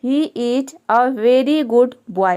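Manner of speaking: very slow, word by word; deliberate dictation pace; slow example reading. Shown as a demonstration. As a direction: read the sentence out slowly for the class. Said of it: "दूसरा sentence है".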